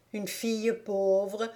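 slow, prim, self-righteous tone; mild, primly scandalized; virtuous indignation. A narrator's reading. Younger Stock sister judging the poor bride, slow prim indignation. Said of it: "avec une vertueuse indignation".

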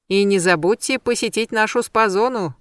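plain reading voice

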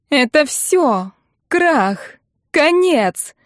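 high, nasal wail of doom; nasal, hysterical notes. A narrator's reading. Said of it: "сказала Ирка гнусавым голосом, в котором проскальзывали истерические нотки". (гнусаво, с истерическими нотками)

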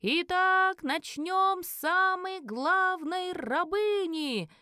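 ringing auction call, vowels stretched; exclaiming elf auctioneer. A narrator's reading. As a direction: proclaim the main lot like a showman. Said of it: "воскликнул рыжеволосый".

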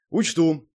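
brief, offhand reply; curt. A narrator's reading. Hero answering Zak on the move, curt. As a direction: toss it back briefly. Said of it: "кратко бросил я в ответ".

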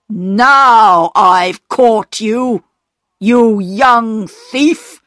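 slow, loud accusing call; stern shout. A narrator's reading. Station master catching Peter with the coal, slow angry shout. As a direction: drag out each word as you shout the accusation. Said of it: "shouted the station master".

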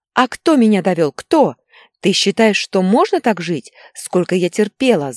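loud, high, worn-out tirade; angry outburst. A narrator's reading. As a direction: rail at husband, then order the child. Wife's outburst in the quarrel. (истеричная тирада на повышенных тонах)

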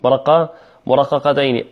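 usual speaking voice

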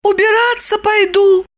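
high, feeble woman's voice; mumbling. A narrator's reading. Tipsy woman says she'll go clean, high mumbling voice. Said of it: "промямлила она".

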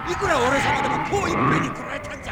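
loud strained cry under attack; 大勢の妖怪に攻められて苦しげに叫ぶ; strained shout. Acted. Overwhelmed by attacking monsters.

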